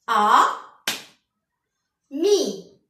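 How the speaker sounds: "A" loud and held, then "mi" after a long pause; teacher sounding out "A, mi" syllable by syllable; split into syllables. Shown as a demonstration. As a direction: clap out "A... mi" as two separate beats. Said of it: "et je frappe".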